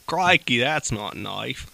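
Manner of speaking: put-on Australian accent. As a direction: do a hammy Australian croc hunter. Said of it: "when I try and do that accent".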